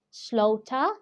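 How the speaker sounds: "slaughter" with a silent final r; British pronunciation. Shown as a demonstration. English teacher repeating a word for learners, "slaughter" British-style without r. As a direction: end "slaughter" on an open "ah". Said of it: "this is a British pronunciation".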